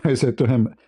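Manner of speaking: ordinary talk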